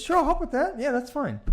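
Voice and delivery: high chipper yes-man; eager spouse. Retold or imitated. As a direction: play the clueless eager husband. Acted spouse reply.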